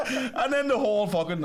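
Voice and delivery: high laugh into speech. Real, not acted. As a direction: laugh then carry on. Laughing through story.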